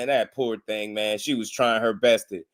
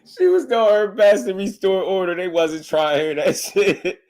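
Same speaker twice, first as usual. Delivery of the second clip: laughing hard; 8 semitones higher, 3 dB louder than usual. Real, not acted. Laughing retelling the host's struggle.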